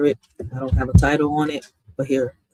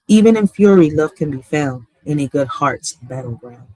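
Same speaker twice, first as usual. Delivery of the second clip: poem recitation; 3 dB louder than usual. Acted. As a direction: read each line of "In a world where fairness fades" as a measured poem, with pauses between lines.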